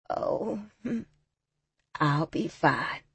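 put-on frail raspy old voice; weak hoarse reply, slow. A narrator's reading. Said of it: "Her voice was raspy".

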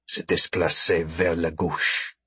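low, quiet, slow French; hushed, deliberate French; speaking French. Acted. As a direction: slip in a covert French instruction. Covert French instruction to hostage.

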